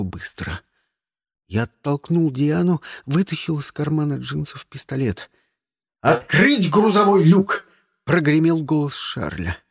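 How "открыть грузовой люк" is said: loud commanding order, stress on грузовой rising. Shown as a demonstration. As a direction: bark the order loudly, грузовой lifted. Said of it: "Нет, не так".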